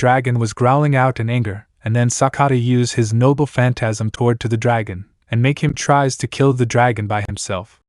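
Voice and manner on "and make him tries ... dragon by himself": plain reading voice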